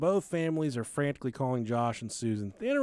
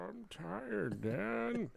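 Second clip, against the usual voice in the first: put-on whiny tired kid; 6 semitones higher, 8 dB quieter, much slower than usual. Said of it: "I want some fucking s'mores".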